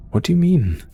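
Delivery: high, startled disbelief; shocked question. Acted. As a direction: leap up in pitch, taken aback by the accusation. Reacts to accusation of mass killing.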